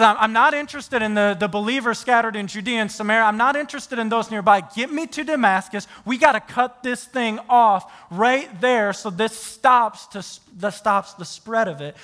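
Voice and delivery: loud, fast Saul ranting to get to Damascus; voicing Saul. Saul demands to stop the Christians, loud and fast. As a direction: spit out Saul's orders, hard and hurried. Preacher voicing Saul's threats.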